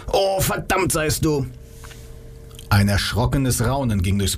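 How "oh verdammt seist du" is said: mock scream. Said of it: "Sekunden später schrie er".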